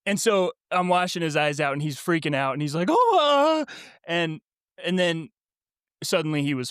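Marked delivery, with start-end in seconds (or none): 2.9-3.7 imitated kid's high-pitched cry; mock panicked wail. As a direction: wail like a child freaking out. Imitates freaking-out child washing eyes.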